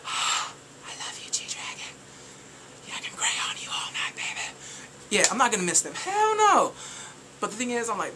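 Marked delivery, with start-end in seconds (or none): 0.1-4.4 soft, slow whisper to an idol; whispered love. Pretend. Whispering to [name]. 5.9-6.7 high, loud refusal; high-pitched emphatic refusal; emphatic hell no. Real, not acted. Refusing to miss concert.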